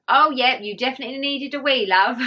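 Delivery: midwife's amused remark. Retold or imitated. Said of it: "it was the way she said it".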